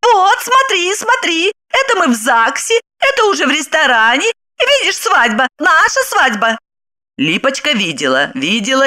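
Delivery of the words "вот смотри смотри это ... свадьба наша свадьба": much higher insistent woman's voice; wife showing photos. Acted. Wife shoves the wedding album at Lipochka, insistent high voice. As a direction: push the album at her, higher, pressing. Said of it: "приговаривая".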